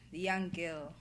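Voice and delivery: usual speaking voice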